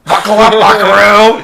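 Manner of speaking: loud, high cartoon yell; shouted catchphrase. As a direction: belt it like a catchphrase. Quoting a ringtone catchphrase.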